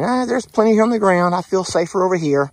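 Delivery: very high, loud timid voice; voicing Rita wary. Owner voicing Rita preferring the ground, very high and wary. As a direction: keep your distance, nervously. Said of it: "Or like".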